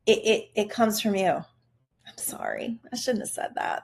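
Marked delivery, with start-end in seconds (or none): 2.1-3.8 softer, nearly whispered apology; sheepish aside. Real, not acted. To her viewers after a blunt remark, a hushed sheepish aside. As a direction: back off the blunt remark quietly.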